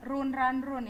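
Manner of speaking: "run, run, run" said as roon, ran, roon; wrong pronunciation. Shown as a demonstration. Teacher warning students off the wrong reading, "run" mispronounced as "roon". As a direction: show the mistake, "run" with a long oo. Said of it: "Bukan run, run, run ya".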